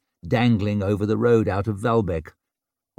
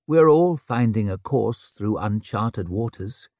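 ordinary delivery, nothing marked

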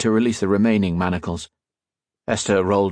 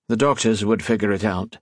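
ordinary delivery

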